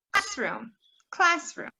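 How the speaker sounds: "classroom" pitched high on "class"; CLASS-room, modelled clearly twice; stress on first syllable. Shown as a demonstration. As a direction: hit "class" in each "classroom". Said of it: "hearing the first syllable".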